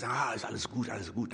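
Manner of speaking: low, hushed brushing-aside; mock soothing under the breath; dismissive self-soothing. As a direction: play it down softly, as if to oneself. Voices someone brushing troubles aside.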